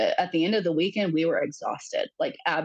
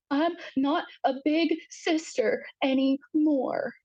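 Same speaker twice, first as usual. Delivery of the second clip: high, slow little-child voice, imitated; crying three-year-old; 9 semitones higher, slower than usual. Crying three-year-old to her mother, imitated high and slow. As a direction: imitate a grieving small child, drawn out and high. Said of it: "this is a three-year-old".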